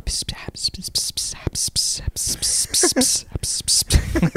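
long whispered sssss hiss; hissing s sound. Retold or imitated. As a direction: hiss like a snake. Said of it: "all the S's".

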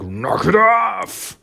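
high, drawn-out roaring yell; mock lioness roar; imitated lion's roar. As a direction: roar it out slowly like an angry lioness. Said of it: "a roar there".

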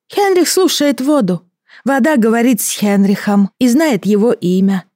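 high, otherworldly creature voice; gurgling alien voice. Acted. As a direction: speak of yourself as a strange creature. Creature speaks of itself.

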